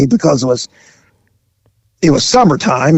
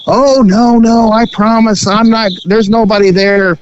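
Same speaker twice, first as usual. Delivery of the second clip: raised pleading woman's voice; imitating the woman; 7 semitones higher than usual. The hitchhiker insisting nobody is home, in a raised mock-innocent voice. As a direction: protest a little too hard, lifted. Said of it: "She goes".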